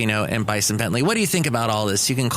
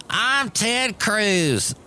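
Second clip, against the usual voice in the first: high, drawn-out mock announcement; slow caricature, pitched way up; Ted Cruz; 9 semitones higher, much slower than usual. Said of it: "Ted Cruz is in self-quarantine".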